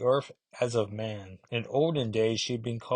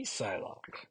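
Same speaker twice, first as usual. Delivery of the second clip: dramatic whisper; 11 dB quieter than usual. Pretend. Dramatic reveal of code name.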